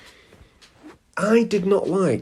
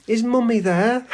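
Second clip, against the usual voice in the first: mock sugary caller, much higher with a rising end; sweet phone caller; 11 semitones higher, 4 dB louder than usual. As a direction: imitate an adult asking a teenager for mummy. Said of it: "people would go".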